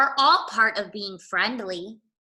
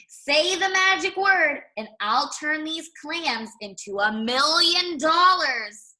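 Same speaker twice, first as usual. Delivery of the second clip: showman pitch; 6 semitones higher than usual. Acted. Crab offers to turn clams into money, high showman voice. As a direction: sell the magic trick. Clam seller tempts rabbit.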